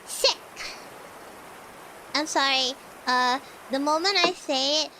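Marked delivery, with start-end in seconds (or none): none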